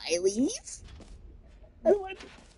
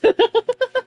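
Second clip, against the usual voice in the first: squeaky real laugh; high burst of laughter; 4 dB louder than usual. Real, not acted. Gets reset by the game.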